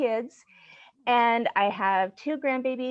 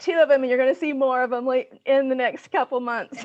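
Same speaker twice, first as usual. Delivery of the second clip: loud, higher, laughing excitement; 4 semitones higher, 6 dB louder than usual. Real, not acted. Grandmother to co-hosts about more grandbabies coming, laughing. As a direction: say it brightly through a laugh.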